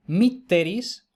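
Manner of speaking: "mitteris" said slowly with the stress on MIT, MIT-te-ris; slow Latin example. Shown as a demonstration. Teacher reading a Latin example to the class, slow and deliberate. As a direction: spell out "mitteris" slowly, leaning on the first syllable. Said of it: "vemos en el primer ejemplo".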